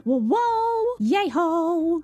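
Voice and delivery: high, drawn-out whoas; delighted cheer. Real, not acted. Player admiring her beautiful plants, delighted. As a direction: whoop over what you've made.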